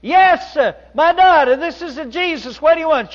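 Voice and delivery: very high, very loud booming call, laughing; loudest God voice. Retold or imitated. Painter bellows down to nun as Jesus, very loud. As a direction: bellow down as God at full blast. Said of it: "say it louder".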